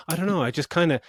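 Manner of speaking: lightly voiced puzzled kid; mock Tina. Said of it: "She's like".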